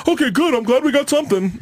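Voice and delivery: imitating the chipper producer; mock chipper producer. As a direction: play the producer as oblivious and upbeat. Producer voiced, much higher.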